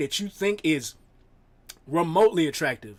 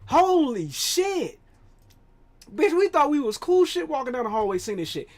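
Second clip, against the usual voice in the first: ordinary talk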